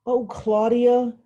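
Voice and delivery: slow, loud, exasperated sigh of a name; fed up with her little sister; older sister's weary reproach; put out. Acted. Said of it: "She sounded put out".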